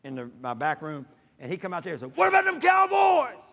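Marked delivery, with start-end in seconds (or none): 2.2-3.4 high, loud gloating taunt; gloating shout. Retold or imitated. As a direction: crow it at him across the room. Son-in-law gloating at each score.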